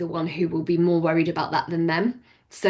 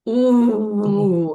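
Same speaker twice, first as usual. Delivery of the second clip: delighted pondering; 5 dB louder than usual. Real, not acted. Reacting to favourite song question.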